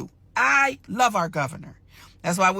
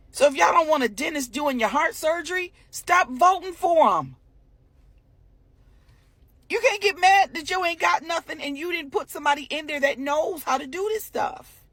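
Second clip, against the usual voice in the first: wired; 6 semitones higher than usual. Real, not acted. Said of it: "I seem a little wired".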